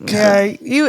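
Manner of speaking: ordinary delivery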